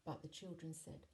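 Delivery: usual speaking voice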